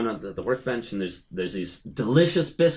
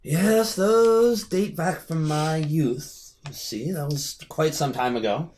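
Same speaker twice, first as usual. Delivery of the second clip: high, drawn-out start easing down; reminiscing old host; 5 semitones higher, slower than usual. Acted. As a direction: reminisce slowly as the host character. Host recalls their youth in character.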